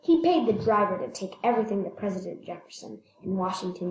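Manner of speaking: plain reading voice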